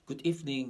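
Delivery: good evening with evening said as ifning, middle vowel dropped; spoken pronunciation. Shown as a demonstration. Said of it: "แต่เวลาเราอ่านจริงคือ". (good evening ออกเสียงรวบ evening เป็น อีฟนิ่ง)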